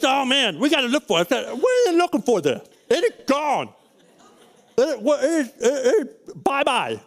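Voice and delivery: dad laughing off the search with his son; mock disbelief. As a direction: tease him that it's gone for good. Dad mocking son's search.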